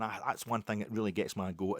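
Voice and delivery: usual speaking voice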